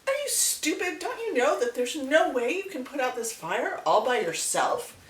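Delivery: high, scornful monkey voice; mock the bird as a scornful monkey; incredulous monkey. Monkey mocking hummingbird, high and scornful.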